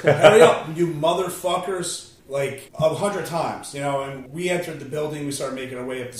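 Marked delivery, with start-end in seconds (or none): 0.0-2.1 loud, higher imitation of yelling; mock yell. Panicked father yelling out the window at firefighters, mock yell. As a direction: holler it like a man calling down to firefighters. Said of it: "the guy's yelling out the window".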